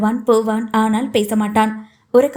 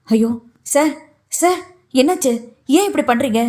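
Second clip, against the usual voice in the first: alarmed assistant; 2 semitones higher than usual. A narrator's reading. Assistant sees boss punching wall.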